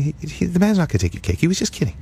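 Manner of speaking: mom reassuring. Retold or imitated. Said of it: "She said".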